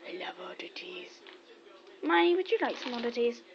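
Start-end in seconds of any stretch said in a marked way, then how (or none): 0.1-1.1 breathy whisper as the dog. Pretend. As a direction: whisper it as the dog's thoughts. Dog's imagined voice whispered.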